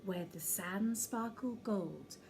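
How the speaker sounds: hushed storyteller whisper; whispered story start; whispered opening. A narrator's reading. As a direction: draw them in with a whisper.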